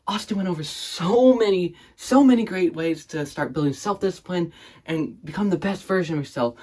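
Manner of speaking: loud, high-pitched hype host; over-excited interviewer. Acted. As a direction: gush like a wildly enthusiastic interviewer. Plays hyped-up interviewer.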